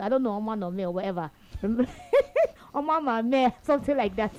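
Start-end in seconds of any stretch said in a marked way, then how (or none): 1.5-2.5 short laugh. Real, not acted. Laughing at the mangled name.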